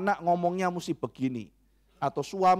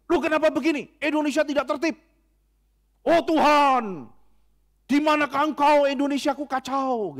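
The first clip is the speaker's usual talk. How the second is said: high, loud, frantic fretting over disorder; exasperated complaint; 9 semitones higher, 4 dB louder than usual. Acted. A control-obsessed man fretting over Indonesia, exasperated and high. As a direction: rant like everything is falling apart. Preacher acts out a control freak.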